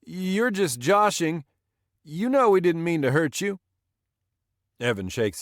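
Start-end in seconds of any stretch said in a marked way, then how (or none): 0.0-3.6 put-on high, loud nervous drunk; wheedling, unsure drunk voice; less bluster. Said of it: "With considerably less bluster".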